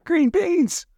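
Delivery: very high, loud, squeaky blurt. Pretend. Blurted silly high exclamation.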